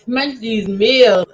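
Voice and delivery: "make these meals" slow, each word stressed; slow emphasis. Real, not acted. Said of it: "When I say make these meals".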